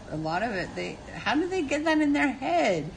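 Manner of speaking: ordinary talk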